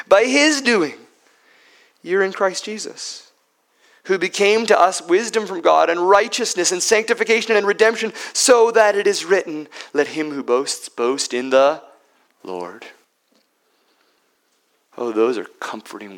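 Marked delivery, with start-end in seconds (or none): 0.1-1.1 his punched high and loud; stress on his. Shown as a demonstration. Preacher repeating Paul's words, stressing his. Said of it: "But by his doing". 2.0-12.9 forceful scripture reading. Acted. Preacher reading Paul's words to the congregation, forceful proclamation.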